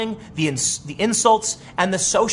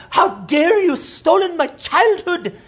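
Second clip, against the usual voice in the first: much higher and louder, slow mock young girl scolding; hammed-up childlike outrage, pitched way up and dragged out; mock Greta Thunberg; 12 semitones higher, 4 dB louder, much slower than usual. Mocking the girl on TV scolding viewers, in a high, loud, slow parody. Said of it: "this young girl going".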